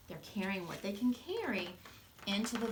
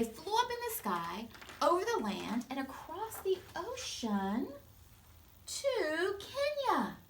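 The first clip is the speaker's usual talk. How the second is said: storytelling; 9 semitones higher than usual. A narrator's reading. Narration building to arrival.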